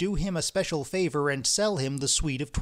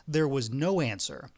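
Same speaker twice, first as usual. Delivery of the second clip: plain reading voice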